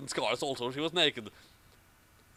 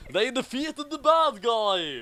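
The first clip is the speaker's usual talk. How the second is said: extra epic; 8 semitones higher, 4 dB louder, slower than usual. Acted. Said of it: "I had to make that line extra epic".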